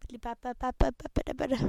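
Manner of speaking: rapid nonsense babbling; fast babble. Pretend. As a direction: rattle the syllables off fast.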